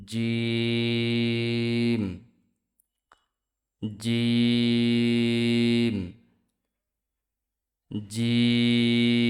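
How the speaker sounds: জিম stretched very long and held level; long drawn-out letter. Shown as a demonstration. Teacher drawing out জিম for learners to copy. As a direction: sustain জিম like a chanted recitation. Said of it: "জিম উচ্চারিত হয়".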